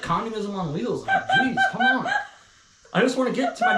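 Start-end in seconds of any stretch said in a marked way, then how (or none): 1.1-2.1 laughing. Real, not acted. Laughing exasperation.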